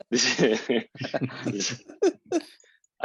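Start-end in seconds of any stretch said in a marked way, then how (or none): 0.0-1.8 giggling through the words; laughing. Real, not acted. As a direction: laugh while saying it.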